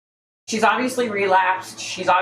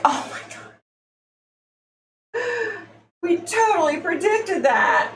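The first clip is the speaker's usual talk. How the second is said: mock psychic prediction; 11 semitones higher than usual. Vlogger parodying psychic predictions, high swooning mock-psychic voice. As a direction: moan it out like a dramatic phony seer. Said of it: "and they're always like".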